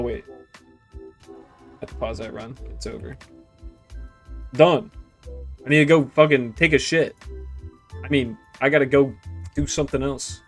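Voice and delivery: ordinary talk, nothing marked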